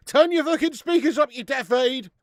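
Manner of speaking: loud, high-pitched telling-off; exasperated shout. Real, not acted. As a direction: yell it at the complaining viewer. Rebuking complaint about low sound.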